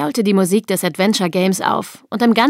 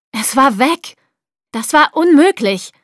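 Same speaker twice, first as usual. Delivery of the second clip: higher, louder, rising disbelief; agitated disbelief; 5 semitones higher, 4 dB louder than usual. A narrator's reading. Ed cannot believe his program vanished, agitated disbelief. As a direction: let incredulity build into protest.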